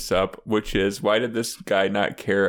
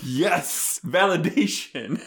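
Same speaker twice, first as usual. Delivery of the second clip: triumphant; 6 semitones higher, slower than usual. Real, not acted. Celebrates being proven right.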